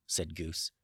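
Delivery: ordinary delivery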